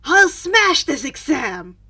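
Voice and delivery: loud, slow, punchy declaration; determined shout. A narrator's reading. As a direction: roar it like a promise. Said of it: "a determined look on his face".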